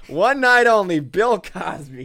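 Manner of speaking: loud, high mock show announcer; sarcastic announcer. Host bills a Comedy Store comeback, mock announcer. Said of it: "I'm being very sarcastic".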